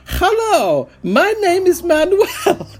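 high, loud hammed-up Mexican accent; mock Mexican accent. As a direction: greet them as a cartoonish Mexican guide. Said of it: "Might be offensive".

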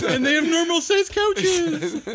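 slow, high, laughing; wheezing the words out through laughter. Real, not acted. Laughs while joking.

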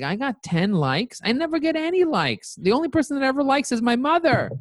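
high-pitched squeal of disbelief; amazed disbelief. Retold or imitated. Retelling his shock at ten likes, high amazed squeal.

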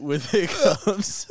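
laughing. Real, not acted. Laughing while teasing.

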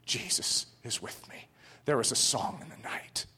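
soft, breathless whisper; hushed whisper. Retold or imitated. Preacher voicing the burning pastor's last words, whispered. Martyr's words amid the flames.

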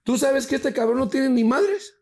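high, loud mock warning; indignant sister. Sister warns girlfriend about penniless brother, indignant. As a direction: imitate a sister trashing her brother.

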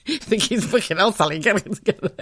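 scolding herself through giggles; high voice breaking into laughter; laughing. Real, not acted.

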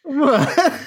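giggly high what; laughing in disbelief. Real, not acted. Laughs at the meme reaction.

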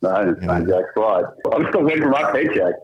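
usual speaking voice

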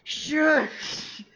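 mock shout. Playfully shouting down cohost.

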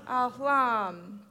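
ordinary delivery, nothing marked

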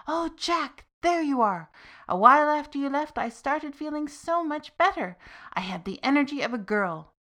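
put-on a touch of an old mother delighted to see her son; lightly voiced mother. A narrator's reading. Mother greeting her son, lightly voiced and happy.

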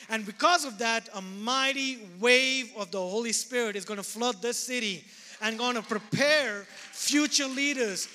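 preaching with rising force; full-voiced pulpit proclamation; fervent preaching. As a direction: preach it out to the congregation.

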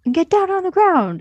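high mock holdup yell; hammed-up holdup command; robber shout. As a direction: bark it like a bank robber. Robbers burst into bank, quoted.

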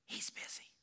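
put-on small boy whispering into the phone; whispering child. Little Jimmy answering the pastor's call, whispering. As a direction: breathe it like a hiding four-year-old. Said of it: "a voice answered with a whisper".

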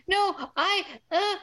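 high, faltering fake distress; mock tearful stammer. [name] caught out by Sam, fake tearful. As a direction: act a badly faked weepy protest. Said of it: "She wipes her fake tears away".

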